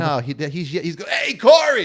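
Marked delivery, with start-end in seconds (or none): 1.1-1.8 high, loud shouted read; shouting the message as Jeff; Jeff's yelling voice. Retold or imitated. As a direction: yell it out like Jeff would. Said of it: "Read this in Jeff's voice".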